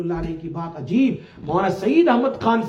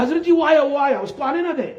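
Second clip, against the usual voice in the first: higher, louder, insistent; upset protest; 5 semitones higher, 3 dB louder, faster than usual. Retold or imitated. Disciple warning the elder, urgent and upset. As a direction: blurt out the alarm to the elder.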